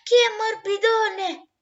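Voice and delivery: high, loud, panicked call for help; Novella, caught by the farmer, asks Mica for help; frightened cry. Acted. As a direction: cry out trembling with fear. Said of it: "Novella tremò".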